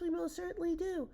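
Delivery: very high, tiny pipe; piping little voice. A narrator's reading. Speck-dweller answers the elephant, piping high. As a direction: pipe up like a minute creature. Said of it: "piped the voice".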